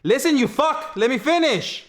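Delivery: sudden furious shout; angry outburst. Real, not acted. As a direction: snap at him, fed up. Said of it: "I hadn't seen that guy".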